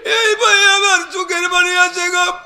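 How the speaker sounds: full-force preaching. Real, not acted. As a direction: cry it out as an impassioned chanted plea.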